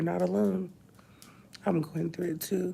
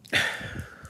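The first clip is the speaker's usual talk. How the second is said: long shaky breath out; tearful sigh; heavy sigh. Real, not acted. Grieving vlogger pausing mid-story, heavy tearful sigh.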